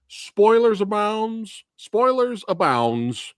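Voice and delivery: slow, high-pitched mock announcement; spoiler announcement. Warning everybody about spoilers, drawn-out announcer call.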